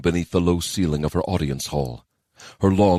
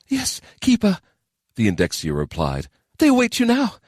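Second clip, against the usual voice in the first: urgent messenger. Acted.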